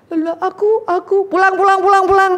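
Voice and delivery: put-on a kid sulking as he's sent home; whining little brother. Young David whining as his brothers send him home.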